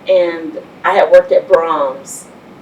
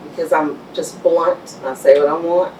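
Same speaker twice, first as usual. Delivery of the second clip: usual speaking voice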